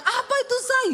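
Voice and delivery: high, loud startled cry; alarmed shout. Retold or imitated. Wife shouting to her husband when something falls, alarmed cry. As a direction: yell out in alarm. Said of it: "Saya teriak".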